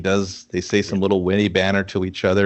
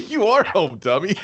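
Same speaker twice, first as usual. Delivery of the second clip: mock scolding; 10 semitones higher than usual. Mocking the character.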